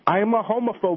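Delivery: high, honking duck-call voice; duck call honks. Acted. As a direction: quack the words like a decoy. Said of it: "I've got the Robertson duck call down".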